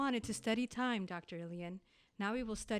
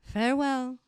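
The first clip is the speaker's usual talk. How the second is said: solemn farewell; 12 dB louder than usual. Acted. Final goodbye from the loop.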